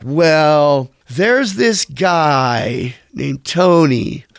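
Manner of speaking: drawn-out, reluctant suggestion; hesitant, wary hedging. Retold or imitated. Bandmate suggesting a hard-to-handle drummer, slow reluctant hedging. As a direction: stretch it out slowly, as if unsure you should mention him. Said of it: "That's how Jay said it".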